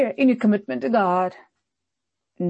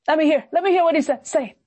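defiant challenge; 8 semitones higher than usual. Retold or imitated. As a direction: taunt the prophet, pitched high. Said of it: "And he said to him".

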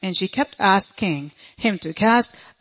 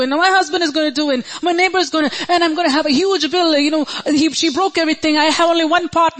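high, hurried mock worrying, pitch raised; mock worrier; 6 semitones higher, faster than usual. Preacher mocking a worrier who imagines disaster, high and hurried. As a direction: rattle off imagined disasters in a higher voice. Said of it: "And say".

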